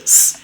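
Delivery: a loud hissed "s"; isolated s sound. Shown as a demonstration. Teacher isolating the final s. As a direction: hiss the "s" alone. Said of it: "esta última S".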